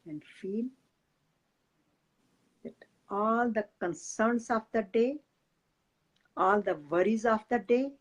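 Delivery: soft, unhurried meditation guide voice; guided meditation. Acted. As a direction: ease the listeners into calm breathing.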